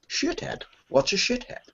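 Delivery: high, soft puzzled Scottish echo; Sean Connery. Retold or imitated. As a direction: repeat the insult baffled in a Scottish lilt. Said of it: "And Sean Connery just goes".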